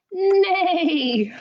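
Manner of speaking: high whinnying neigh; whinny it like a horse. Shown as a demonstration. Librarian asking kids to neigh along, high whinny. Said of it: "All I know is horses go".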